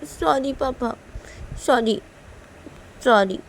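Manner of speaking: put-on small, meek child voice; apologetic little daughter. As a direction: play a little girl saying sorry to her father. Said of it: "लिया बोलती है PU को कि". (मासूम बच्ची की माफ़ी)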